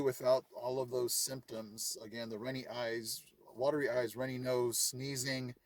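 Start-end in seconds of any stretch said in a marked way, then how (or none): none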